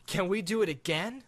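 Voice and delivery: put-on eager boy. Boy begging the dragon for another ride, eager.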